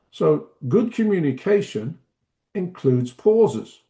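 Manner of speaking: a clear pause after "communication", then "includes pauses" slowly; deliberate pausing. Shown as a demonstration. Trainer demonstrates pauses to teachers, stopping after "communication" and "pauses". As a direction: break after "communication" and leave silence after "pauses". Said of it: "That was a pause".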